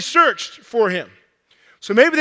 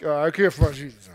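sheepish confession; 7 semitones lower than usual. Acted. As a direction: mumble it like a guilty husband. Joseph repeats timidly to Mary.

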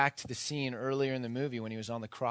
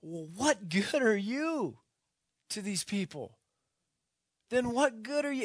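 stunned disbelief; 9 semitones higher, 3 dB louder than usual. Retold or imitated. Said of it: "And he says".

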